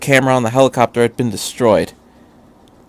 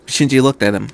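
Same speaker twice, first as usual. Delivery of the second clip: ordinary delivery, nothing marked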